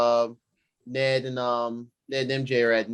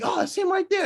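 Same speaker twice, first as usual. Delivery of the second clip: much higher, eager pointing-out; excited realization. Retold or imitated. Friends spotting Spider-Man in the alley, excited high blurt. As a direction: spot him and blurt it out, thrilled. Retelling excited movie characters.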